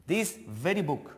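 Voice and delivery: slow, "very" clearly punched; stress on very. Shown as a demonstration. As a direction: hit the word "very" to show emphasis. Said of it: "more stress on this word very".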